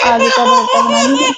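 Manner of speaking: laughing through the line; amused little laugh. Real, not acted. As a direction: chuckle as you say it. Laughs at her own joke.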